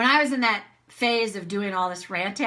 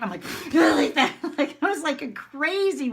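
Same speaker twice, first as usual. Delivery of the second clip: laughing; 5 semitones higher than usual. Real, not acted.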